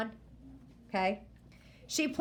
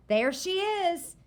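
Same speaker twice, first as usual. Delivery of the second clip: put-on proud, high, slow boy's voice; 10 semitones higher, 4 dB louder, slower than usual. A narrator's reading. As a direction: show off the raft with pride. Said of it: "Dewey said proudly".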